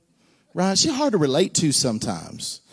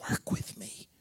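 breathy aside whisper; 15 dB quieter than usual. Real, not acted. Preacher whispering to a quiet congregation for response. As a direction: plead under your breath.

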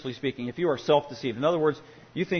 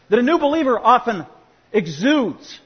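ordinary delivery

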